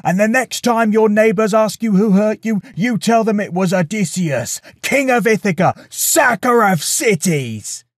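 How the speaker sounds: high, defiant long-distance shout; outraged yell. A narrator's reading. Odysseus taunts the Cyclops from his boat, outraged yell. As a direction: howl your defiance across the water. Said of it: "he yelled across the water".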